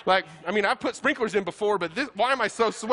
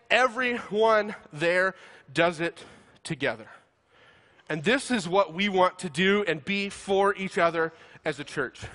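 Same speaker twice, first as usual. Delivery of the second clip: tender emphasis; 3 semitones lower, slower than usual. Real, not acted. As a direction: drop to a near hush for the point. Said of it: "sermon point softens".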